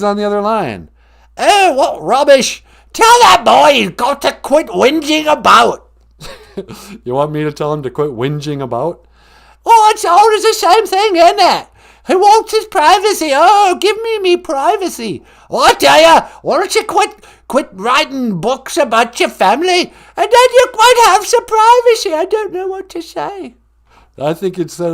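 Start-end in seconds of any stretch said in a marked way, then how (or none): 1.4-5.9 loud, high, scolding British grandmother; hammed-up royal indignation; Queen Mum. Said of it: "It's the Queen Mum". 9.7-23.6 loud, high, scolding British grandmother; hammed-up royal indignation; Queen Mum. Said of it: "It's the Queen Mum".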